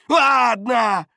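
high, loud shout of surrender; exasperated yell. A narrator's reading. Бардак gives in to his questioners, loud exasperated yell. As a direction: shout it out, giving in.

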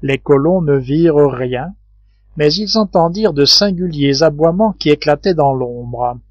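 ordinary delivery

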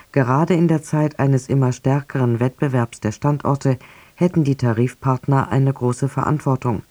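usual speaking voice

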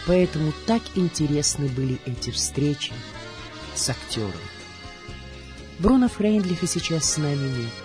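usual speaking voice

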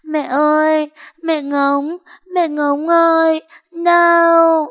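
put-on high, loud, slow child's wail. A narrator's reading. Injured Monkey wails for her mother, loud and high. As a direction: scream for mother in pain. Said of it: "liên tục gào khóc gọi mẹ".

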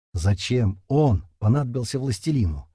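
usual speaking voice

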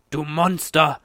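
loud, hateful yell; angry roar. A narrator's reading. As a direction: roar the accusation at a monster. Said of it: "brüllte Paul". (wütend brüllen)

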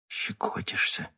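soft unvoiced whisper; quiet. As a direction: breathe it softly, barely voiced. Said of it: "тихо сказал Кирилл".

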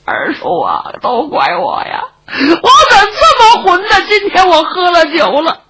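sobbing apology, breaking upward into a cry; crying remorse. Acted. Nephew sobbing his apology to his wounded uncle. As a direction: wail it out, full of regret. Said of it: "段夺哭了，后了悔了".

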